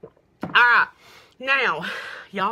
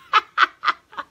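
a higher, louder burst of laughter; bright laugh; 10 semitones higher, 11 dB louder than usual. Real, not acted. Joking to viewers about not finding a brush: real laugh. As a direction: laugh at your own quip. Laughs at her own joke.

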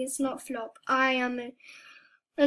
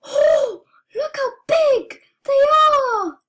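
high, loud gasp of shock, drawn out slowly; gasping surprise; 11 semitones higher, 8 dB louder, slower than usual. Real, not acted. Player spotting giant rivals, gasping in high loud shock. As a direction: gasp at how huge they are. Sees huge players in game.

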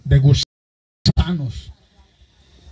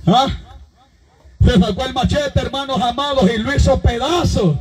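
raised, driving pulpit voice, pushing each phrase; fiery preaching; 5 semitones higher than usual. Acted. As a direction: preach it to the whole congregation. Preacher retelling Saul story.

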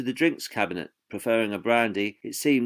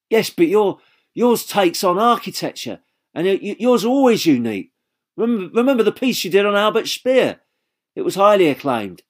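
higher, louder eager persuading; 10 semitones higher, 7 dB louder than usual. A narrator's reading. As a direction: press the point eagerly.

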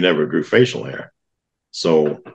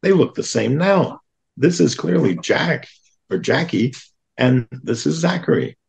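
higher, incredulous scoff at the dad; mock disbelief, pitched up high; scoffing wife; 7 semitones higher than usual. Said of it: "my wife scoffs and goes".

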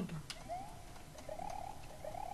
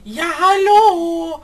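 slow, much louder drawn-out hallo; indignant shout; 6 semitones higher, 13 dB louder, much slower than usual. Real, not acted. Sister shouting back indignantly at teasing. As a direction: stretch the hallo in outrage.

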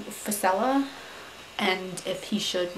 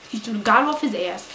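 usual speaking voice

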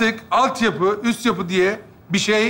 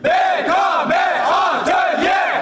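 high, drawn-out shouted count-in; rally the troupe with a big chant; team cheer; 5 semitones higher, much slower than usual. Acted. Actor leads the troupe's count and cheer, shouting.

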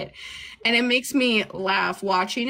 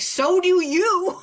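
high, slow, louder mock yell from the back seat; retold yell; 10 semitones higher, 5 dB louder, much slower than usual. Sister yelling at dad from the back seat, high and slow. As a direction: drag it out high and loud. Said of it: "pop up in the back seat of the car and yell".

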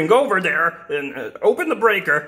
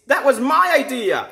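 higher, outraged objection; indignant protest; 6 semitones higher than usual. Acted. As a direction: protest that your idea was stolen. Protesting a stolen idea.

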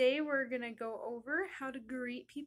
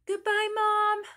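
put-on high, loud child's farewell; calling out goodbye; 8 semitones higher, 11 dB louder than usual. Story child leaving Mom, calling out loudly.

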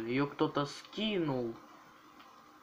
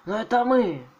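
loud reveal; 8 semitones higher, 9 dB louder than usual. Acted. Bandit reveal.

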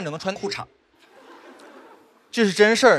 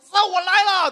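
high-pitched mock morning war cry; bursting with energy; 11 semitones higher, slower than usual. High achiever springing out of bed, exaggerated energetic cry. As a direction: leap into it with exaggerated zest. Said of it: "每天精力非常充沛的人".

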